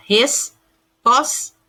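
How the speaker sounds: resposta broken slowly as "res, pos, ta"; slow pauses between each syllable of resposta. Shown as a demonstration. Teacher giving pupils an imperfect-cluster example, syllable by syllable.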